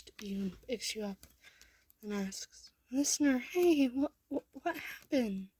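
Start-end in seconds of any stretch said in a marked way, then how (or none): 2.9-5.5 worried. A narrator's reading. Mikey rushes to crying child.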